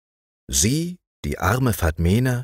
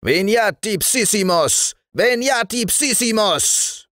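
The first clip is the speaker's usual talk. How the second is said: much higher, far-off demon roar, slowing; booming devil voice. A narrator's reading. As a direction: bellow the Latin like a fiend. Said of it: "schrie mit dröhnender Stimme".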